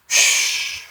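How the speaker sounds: imitated long "shh"; soft drawn-out hush; shushing. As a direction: hiss a shush. Imitated hushing sound in retelling.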